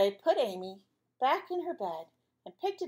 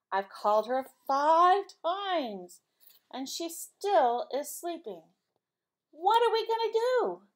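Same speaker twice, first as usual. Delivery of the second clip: higher, louder fretting mom rising to a wail; worried mother; 7 semitones higher, 5 dB louder, slower than usual. Acted. Mother at breakfast fretting over sleeping Amy. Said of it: "said her mother".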